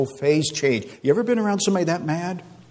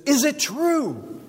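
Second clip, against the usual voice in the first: drawn-out, high-pitched disbelief; incredulous; 12 semitones higher, much slower than usual. Acted. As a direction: stretch it out high as an astonished king. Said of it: "with incredulity in his voice".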